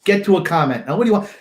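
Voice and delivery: ordinary talk, nothing marked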